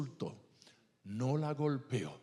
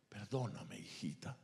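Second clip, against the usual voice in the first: much softer, lower broken plea; tearful father; 6 semitones lower, 9 dB quieter than usual. Retold or imitated. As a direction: barely get the words out, grieving. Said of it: "comenzó a llorar".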